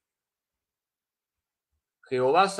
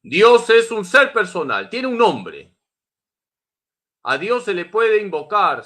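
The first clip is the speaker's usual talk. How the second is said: louder and higher, proclaiming the point; forceful preaching; 5 semitones higher, 7 dB louder than usual. Acted. Preacher repeating the point to the faithful, now forceful. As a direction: ring it out like a preacher to the hall.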